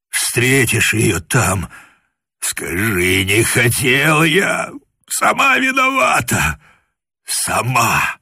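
slow, pained confession; tormented, pleading it's not his fault; anguished plea. Acted.

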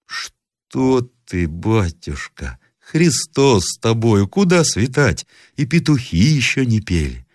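slow, high granny voice; chiding grandmother. A narrator's reading. Grandmother tells grandson dawn is far off, slowly chiding. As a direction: draw it out, fondly dismissing the child.

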